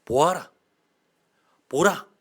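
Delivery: loud, high cry to a crowd; attention-grabbing call. Shown as a demonstration. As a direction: call out sharply to seize everyone's attention. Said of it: "주의를 이렇게 확 집중시키는 거예요". (주의를 확 끄는 큰 외침)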